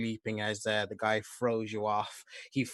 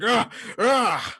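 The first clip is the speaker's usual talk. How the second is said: two high, forceful yelled grunts; loud struggling grunts; 13 semitones higher, 12 dB louder than usual. Acted. Attacker as the player lands on his back, yelled grunts. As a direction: cry out as if jumped on. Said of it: "he's just like".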